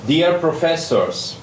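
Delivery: normal talking voice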